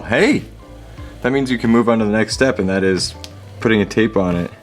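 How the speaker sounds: ordinary delivery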